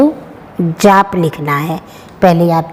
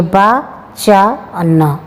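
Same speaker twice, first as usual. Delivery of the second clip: चा न drawn out, very slow, each syllable apart; slowly sounding out चा न; slow spelling; much slower than usual. Shown as a demonstration. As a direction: stretch out चा न for young learners. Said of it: "इस प्रकार वचन लिखना है".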